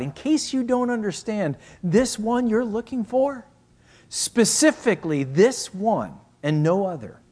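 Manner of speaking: high-pitched, insistent preaching; emphatic preaching. Real, not acted. Preacher driving the emphasis home.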